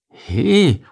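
startled, drawn-out complaint; protest, dragging the question out; complaining. A narrator's reading. Bodo to the roaches strapping him in, complaining. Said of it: "beschwerte er sich".

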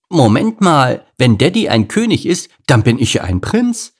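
put-on high, loud kid voice; high childlike announcement; cocky lion cub. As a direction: pipe up as a boy working it out. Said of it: "stellte Lars fest".

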